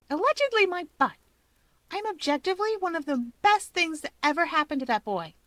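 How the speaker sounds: put-on high indignant girl voice; huffy, insulted comeback; affronted and sure of herself; indignant Rika. A narrator's reading.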